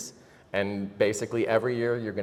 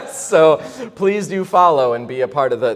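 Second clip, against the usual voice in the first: loud, high, laughing through words; laughing while talking; 7 semitones higher, 11 dB louder than usual. Real, not acted. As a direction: still laughing, pushing the words out. Laughing while inviting fans.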